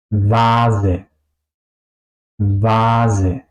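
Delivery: "vase" with a voiced v and long a; V como W alemana. Shown as a demonstration. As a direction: say "vase" slowly as "vaa-ze". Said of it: "En este caso suena como una". ("vase" con V sonora, no F)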